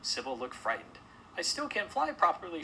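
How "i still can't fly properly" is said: high, loud moan. A narrator's reading. Frightened swallow lamenting her flying, high moan. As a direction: wail it as a frightened little bird. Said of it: "she moaned".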